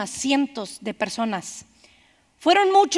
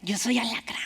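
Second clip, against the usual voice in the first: whispered echo of the line, much softer; whispered repeat; 3 semitones lower, 9 dB quieter than usual. A narrator's reading. Scorpion repeating his nature to the frog, whispered. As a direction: breathe it out like a dark secret.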